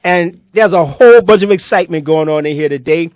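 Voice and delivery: big, loud show-host announcement; hyped-up livestream host calling the room to attention; announcer intro. Acted. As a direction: welcome the crowd back like a showman. Host opens show segment.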